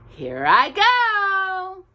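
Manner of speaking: high, slow, eager engine; rising to the challenge, slowly; determined. Acted. Engine starts racing the horse, high and slow. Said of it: "said Tootle to himself".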